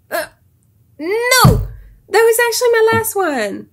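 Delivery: high, loud cry of dismay; real shout of disbelief; shocked outcry. Real, not acted. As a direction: yelp as the answer fails. App marks her answer wrong.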